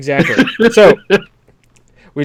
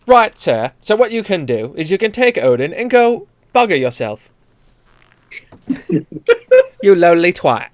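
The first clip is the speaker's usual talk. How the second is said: hammed-up British insult in a put-on English voice; a rough English accent, half-hearted; mock English accent; 4 semitones higher, 5 dB louder than usual. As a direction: play a cheeky English head telling Odin off. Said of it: "That was, like, weird".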